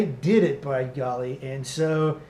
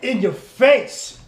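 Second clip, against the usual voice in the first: slow, high, loud taunt; hype shout; 8 semitones higher, 8 dB louder, slower than usual. Acted. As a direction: bellow it at the camera. Hyped show intro shout.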